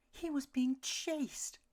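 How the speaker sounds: high, slow pleading mama; arguing mother. A narrator's reading. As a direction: defend her son. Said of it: "Mama argued back".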